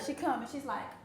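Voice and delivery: ordinary talk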